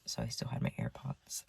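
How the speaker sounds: secretive whisper; whispered aside. Real, not acted. Teen confiding to viewers she kept AirPods, whispering. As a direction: let them in on the secret, hushed.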